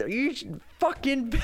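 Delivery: high-pitched mock sputtering rage; sputtered cursing. Old man cursing at the sky, imitated sputtering. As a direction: splutter curses, acting out helpless fury. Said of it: "cursing at the sky".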